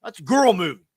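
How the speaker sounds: put-on high, loud kid protest; bratty young boy. Young boy refusing to watch Titanic, loud high protest. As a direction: whine like a small boy refusing a girl movie. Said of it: "the first time when it came out".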